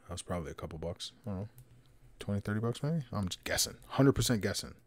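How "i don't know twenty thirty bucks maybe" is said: usual speaking voice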